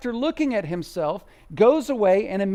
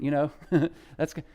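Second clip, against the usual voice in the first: usual speaking voice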